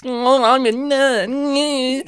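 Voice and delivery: high, very slow put-on nerd voice; nerdy voice. Imitating the actor's nerdy voice, slow and high. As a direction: drag it out like a timid nerd. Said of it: "he's got a nerdy voice".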